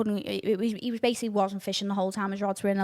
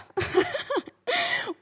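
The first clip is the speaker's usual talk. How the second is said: high laugh. Real, not acted. Laughs at herself.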